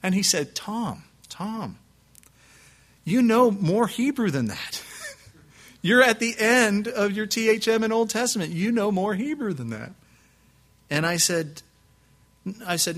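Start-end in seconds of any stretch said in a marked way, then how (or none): 0.6-4.8 lightly voiced professor, pitched up, drawn-out reproach; professor's incredulity. Hebrew professor to a student asking to sit in, incredulous. Voicing his surprised professor. 5.8-9.9 higher-pitched insistence, lightly acted professor; professor's incredulity. Professor to his Old Testament student, incredulous.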